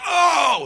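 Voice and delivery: loud high shocked "oh"; imitated crowd groan. Everyone sees Iggy fall, shocked cry. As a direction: recoil out loud like a wincing crowd.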